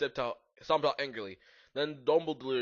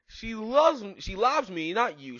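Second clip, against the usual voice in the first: high, raised jealous retort; shouted; 8 semitones higher, 6 dB louder, slower than usual. A narrator's reading. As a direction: insist loudly, voice pitched up. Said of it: "shouted Vampire".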